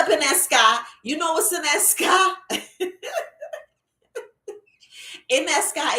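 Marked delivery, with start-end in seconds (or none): 2.4-3.6 high, soft run of laughter; wordless giggle at your own question; amused laugh. Real, not acted. Coach asking what's in that sky, amused laugh.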